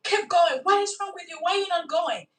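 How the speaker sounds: impatient master scolding his beast; exasperated rider. Retold or imitated. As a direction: snap at the stubborn donkey. Balaam yelling at his donkey.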